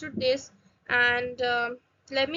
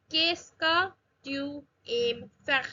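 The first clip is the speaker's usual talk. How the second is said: slow French, phrase broken into pieces; drawn-out teaching pronunciation with gaps between words; slow demonstration; slower than usual. Shown as a demonstration. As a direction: model it word by word for learners. Teacher models phrase slowly, then naturally.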